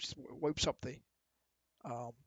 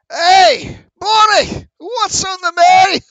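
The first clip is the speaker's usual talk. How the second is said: much higher, loud shout, drawn out; shouting to the kitchen; 24 dB louder, much slower than usual. Acted. Innkeeper yells to Bonnie in the back, loud and high. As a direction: yell back through to the kitchen.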